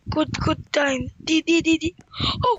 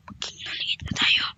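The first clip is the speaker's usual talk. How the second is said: whisper; 5 dB quieter than usual. Acted. Sneaking as ninja.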